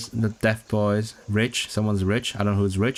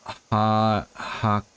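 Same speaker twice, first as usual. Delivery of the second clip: ordinary delivery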